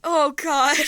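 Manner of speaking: loud, high, shocked gasp of words; recoiling from a drink. Acted. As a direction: cry out as the drink hits hard. Reacting to a strong drink.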